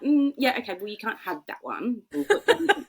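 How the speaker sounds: high-pitched hedging mum, breaking into a laugh; awkward placating. Retold or imitated. Mother retelling her reply to her son, awkward placating.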